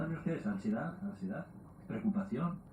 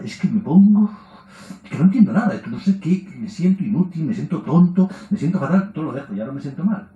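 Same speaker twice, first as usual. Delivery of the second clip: higher, louder student complaining with a laugh; whining student; 5 semitones higher, 5 dB louder than usual.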